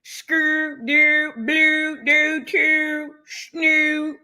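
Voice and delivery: lightly exaggerated Baltimore vowels on nonsense words; mock Baltimore accent. Teasing Baltimore people about how they talk, mock Baltimore accent. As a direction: imitate Baltimore talk with a string of rhyming syllables. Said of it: "How they be talking, um, the Baltimore people?".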